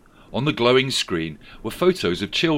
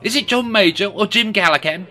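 high, raised politician voice; Rachel Reeves voice; 12 semitones higher, 8 dB louder than usual. A narrator's reading. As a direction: ask it eagerly.

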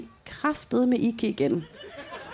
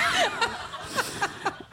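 high unvoiced wheezing laugh; helpless laugh; 12 semitones higher, 5 dB quieter than usual. Real, not acted. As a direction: dissolve into a breathless wheeze.

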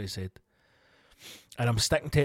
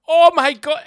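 sudden high shriek of fright, much louder; startled shriek; 13 semitones higher, 13 dB louder than usual. Retold or imitated. Van man spots something in the car park, high frightened shriek. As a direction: shriek like something leapt out at you. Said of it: "he just goes".

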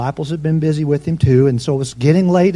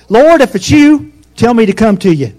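Peter bursting to step out onto the water; eager call; 6 semitones higher, 7 dB louder than usual. Acted. Peter calling eagerly to Jesus across the water.

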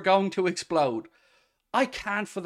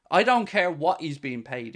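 ordinary delivery

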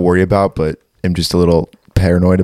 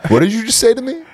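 mock outrage; 14 semitones higher, faster than usual.

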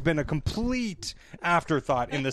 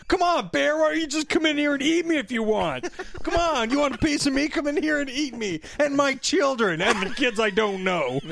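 taunting the bear; 8 semitones higher than usual. Retold or imitated. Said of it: "Michael Cassidy's like".

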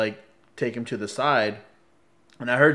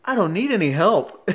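indignant protest; 6 semitones higher, 3 dB louder than usual. Retold or imitated. Man objects to guard's help.

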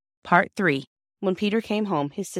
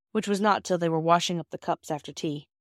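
ordinary delivery

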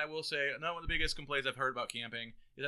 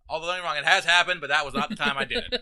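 ordinary delivery

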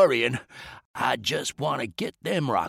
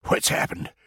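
hushed gasp; 5 dB quieter than usual. A narrator's reading. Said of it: "he bawled".

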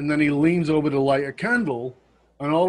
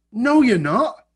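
emphatic loud retort; 9 semitones higher, 7 dB louder, slower than usual. Acted. Retorting to imagined Western students.

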